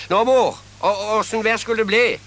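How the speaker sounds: put-on son calling loudly to his old mother; loud call home asking about the weather. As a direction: call out to mother across the house.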